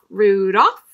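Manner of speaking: usual speaking voice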